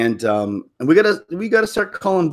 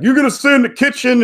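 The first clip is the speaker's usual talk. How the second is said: high, loud mocking order; exaggerated bossy quote; mock sexist demand; 10 semitones higher, 5 dB louder than usual. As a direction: imitate someone bossing, raised and louder.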